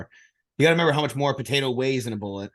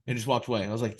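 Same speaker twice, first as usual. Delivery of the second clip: normal talking voice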